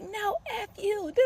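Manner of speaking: high, slow mock defiance; imitated cussing out. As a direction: mimic his cussing in a drawn-out high voice. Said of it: "he cussing me out".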